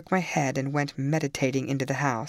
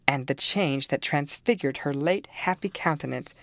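plain reading voice

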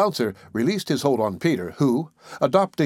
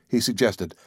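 ordinary delivery, nothing marked

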